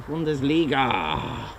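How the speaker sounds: put-on Bundesliga drawn out in a German accent; slow German pronunciation of Bundesliga. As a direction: say Bundesliga slowly, as a German would. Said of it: "My name is [name] from Germany".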